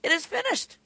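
soaring repeat. Acted. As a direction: lift "finished" up high in wonder.

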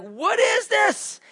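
high, slow, drawn-out puzzled question; mock bewilderment. Preacher voicing Israelites finding manna, hammed-up bewilderment. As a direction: play it like baffled Israelites staring at the ground. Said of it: "they're gonna be like".

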